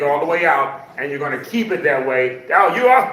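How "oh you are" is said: playful tease. Real, not acted. Teasing a student at the end.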